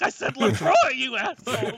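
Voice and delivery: a spoiled star dog yelling at the crew; diva dog tantrum. Pretend. The star actor dog throws seltzer at the crew, high shrieking mock fury. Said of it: "throwing a can of seltzer, and he's like".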